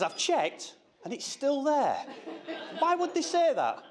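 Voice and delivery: high, slow puzzled daughter voice; imitating daughter. Dad quotes daughter about gullible.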